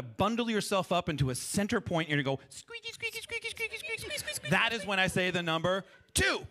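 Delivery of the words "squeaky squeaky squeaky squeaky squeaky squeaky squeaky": squeaky squeaky in a tiny high soft mouse voice; mouse squeak. Shown as a demonstration. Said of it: "It's a mouse".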